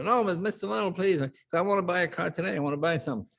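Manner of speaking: imitating an eager salesman pleading. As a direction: beg him to stay, a bit hurried.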